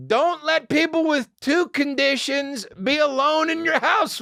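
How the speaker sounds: very high, stretched-out comic ranting; mock outrage. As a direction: rant in a high, incredulous voice. Mock outburst over her two conditions.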